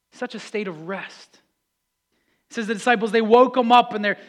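ordinary delivery

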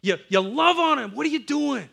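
put-on a twelve-year-old boy yelling at his dad; angry demand. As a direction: confront your father, shouting. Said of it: "and I'm like".

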